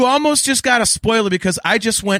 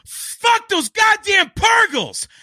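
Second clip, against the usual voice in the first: slow, high, yelled curses; furious shout; 8 semitones higher, slower than usual. Real, not acted. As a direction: roar it out in real outrage. Furious rant about the Purgles.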